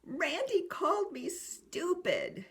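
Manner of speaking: put-on tearful child. Child admitting a hurtful name, slow and high. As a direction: play the upset child telling on Randy. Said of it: "finally they say".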